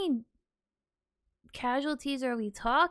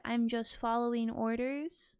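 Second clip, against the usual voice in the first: much softer, subdued and sorrowful; sad, hushed quote; 4 semitones lower, 7 dB quieter than usual. Retold or imitated. Listener pitying the Iranian crew, softly sad. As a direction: let it sink in quietly, moved to pity. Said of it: "That makes me wanna cry".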